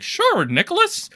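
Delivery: mock a sellout agreeing sweetly; mocking simper. J-Stock voiced caving to Nicholas, mocking simper. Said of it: "was like".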